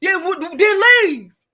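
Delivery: high, loud puffed-up Martin; blustering Martin yelling then leave; Martin impression. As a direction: act all big and bold like Martin. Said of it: "giving Martin".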